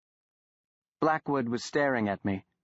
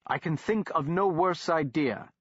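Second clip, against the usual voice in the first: shot back; 5 semitones higher than usual. A narrator's reading. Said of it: "shot back my master".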